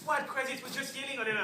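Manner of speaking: high, loud retort; exasperated shout. Acted. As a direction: shout back at the wife in disbelief. Husband shouts back in quarrel.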